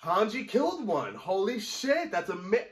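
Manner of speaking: high, loud burst of real amazement; excited outburst. Real, not acted. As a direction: yell it out in sheer disbelief. Reacting as Hanji kills titan.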